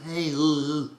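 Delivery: high, small character "hey"; little high voice, lightly nasal. Shown as a demonstration. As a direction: pitch it up with a tiny bit of nasality. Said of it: "it's a little smaller".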